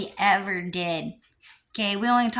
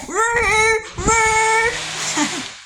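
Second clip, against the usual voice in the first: high, loud real laughter; real high-pitched laughing fit; burst of laughter; 12 semitones higher, 3 dB louder than usual. Real, not acted. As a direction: crack up at the joke. Laughing at his own joke.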